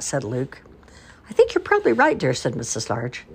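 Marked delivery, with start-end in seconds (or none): 1.3-2.3 high sing-song. A narrator's reading. Mother concedes.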